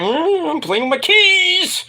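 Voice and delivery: high, drawn-out coy imitation; mock coy woman. Kiana hinting at her door, high mock coy voice. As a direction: stretch it out high, hinting for a kiss.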